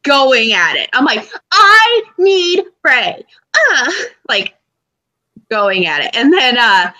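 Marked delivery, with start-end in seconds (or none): 1.5-3.2 high, slow wailing yell; yelling at top of lungs. Retold or imitated. Annoying the rude neighbour, slow high wailing yell. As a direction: bellow it like a frustrated fan, drawn out. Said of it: "literally at the top of my lungs".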